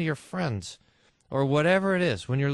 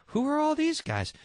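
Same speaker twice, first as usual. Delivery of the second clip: angel asks; 13 semitones higher than usual. Acted. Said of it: "And the angel says to John".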